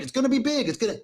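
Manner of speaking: high-pitched, hammed-up politician impression; playful boastful impersonation; Donald Trump. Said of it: "does that sound like Donald Trump".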